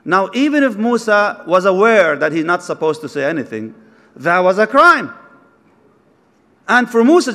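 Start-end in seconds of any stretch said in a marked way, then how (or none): none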